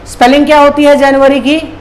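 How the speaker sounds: ordinary talk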